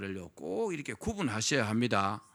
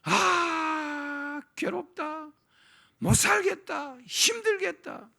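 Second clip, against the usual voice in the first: much higher, slow whining moan; whiny complaint; 14 semitones higher, 3 dB louder, much slower than usual. Retold or imitated. Preacher mocking a complainer's self-pity, high whining moan. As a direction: whine like someone worn out and sorry for himself. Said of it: "계속 죽겠다고".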